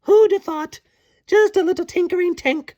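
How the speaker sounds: hammed-up high-pitched boasting; boastful Tink. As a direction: gloat as a smug tiny fairy. Boastful Tink monologue, shrill.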